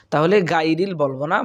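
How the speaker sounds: usual speaking voice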